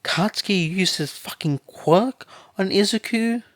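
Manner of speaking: high, loud, shocked disbelief; shocked outburst. A narrator's reading. Mother learning her son hurt Izuku, shocked and loud. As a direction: spit it out high and loud, stunned.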